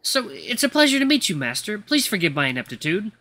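timid, deferential servant with ums and pauses; nervous. Acted. New butler introduces himself to his master, nervously formal. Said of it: "he seems really nervous to be around me".

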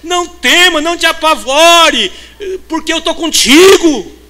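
shouted high, hammering the question; fiery pulpit shout; full-force preaching. Acted. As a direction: preach it out at the top of your voice.

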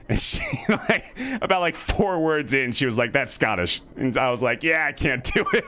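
high, helpless laughter through the words; cracking up mid-story; laughing while talking. Real, not acted. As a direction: tell it through rising laughter. Laughs telling failed accent story.

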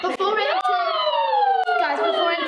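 very high slow chipmunk voice; squeaky voice changer. Acted. Voice changer announcement.

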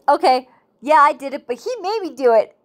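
playful imitation of a defensive excuse; mock blame-shifting. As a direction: pass the blame on, hammed-up. Acting out Adam shifting the blame.

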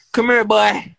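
loud, high call. Real, not acted. Streamer yelling at his friend to come over, loud. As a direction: holler it across the room. Calling him over again.

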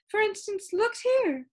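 put-on high, slow complaining old lady voice; a fussy, lilting lady with static in her; complaining sound keeper. Sound Keeper answering the visitor, high and complaining.

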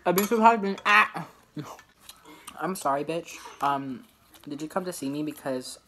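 very low, soft, dismissive diva. Pretend. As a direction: drop the voice down low and sneer. Plays office diva character.

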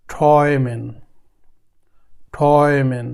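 ঠয়মেন said twice with a clear অয় vowel; German word demo. Shown as a demonstration. Teacher demonstrating the German word to learners, careful repetition. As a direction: model ঠয়মেন slowly for the class. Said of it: "এখানে কি আছে? এ উ".

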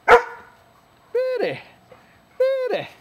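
very high squeak of praise for the dog. Real, not acted. Owner praising pretty dog, squeaky baby talk.

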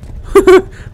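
a high burst of laughter. Real, not acted. Gamer after dying, high laugh. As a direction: laugh off the shock.

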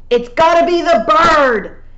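loud, high, drawn-out yell; desperate yell. A narrator's reading. Rob Anybody yelling desperately at the clan. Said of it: "yelled Rob Anybody desperately".

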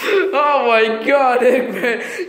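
high, slow laughing taunt; mocking laugh. Sonic mocks the trapped Eggman, laughing through the words. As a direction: laugh right at him.

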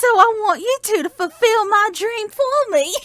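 high, theatrical Southern belle voice. Acted. Aging professor passes on the dream, Southern belle lament. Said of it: "Why is he now a Southern Belle?".

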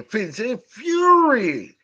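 slow, drawn-out hype call; fight announcer. Pretend. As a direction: announce it like a boxing match.

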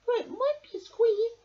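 high squeaky aside; high little mouse. A narrator's reading. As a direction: mouse admitting a snag. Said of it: "suggested Mouse".

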